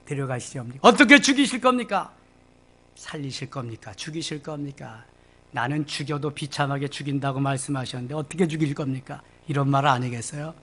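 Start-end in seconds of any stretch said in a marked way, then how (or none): 0.8-2.1 higher, louder demand; loud prying demand; pushy question. Acted. Peter pressing about John. 2.9-9.2 much softer, conspiratorial asking; soft scheming question; sly murmur. Acted. Peter's scheming inner question.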